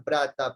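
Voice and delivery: «prata» con r en lugar de l; mala pronunciación. Shown as a demonstration. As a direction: di «prata», cambiando la l de «plata» por una r. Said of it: "no saben pronunciar la R". («prata», con erre tras la p)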